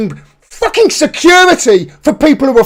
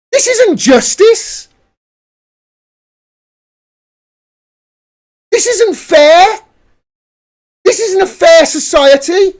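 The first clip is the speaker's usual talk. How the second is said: high, slow, pressed outrage; slow, heated protest; indignant; 7 semitones higher than usual. Real, not acted. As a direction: lean on each word, furious. Indignant rant.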